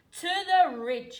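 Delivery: high, loud and pompous; grand proclamation. A narrator's reading. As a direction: proclaim it, chin in the air. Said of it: "he proclaimed with his chin in the air".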